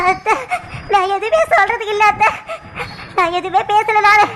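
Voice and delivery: tearful, sobbing refusal to explain; crying. Acted. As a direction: choke out words between sobs. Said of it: "அழுதுகிட்டே இருக்கிற".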